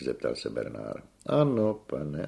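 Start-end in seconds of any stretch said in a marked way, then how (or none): 1.3-2.3 high, drawn-out affirmation; theatrical singer's pride; proud tenor. A narrator's reading. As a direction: savour the yes grandly. Tenor proudly confirms he sings.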